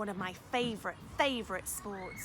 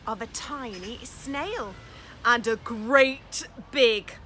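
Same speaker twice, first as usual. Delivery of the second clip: put-on picture-book reading for children; lively storytime narration; story narration; 6 dB louder, slower than usual. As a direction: read it aloud with wonder for little ones. Reads picture book aloud.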